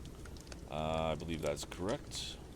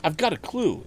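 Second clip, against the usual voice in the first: higher and louder, bursting with news; a young man's excited cry; excited exclamation; 12 semitones higher, 8 dB louder than usual. A narrator's reading. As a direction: rush in eager to tell Dad. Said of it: "exclaimed Tom".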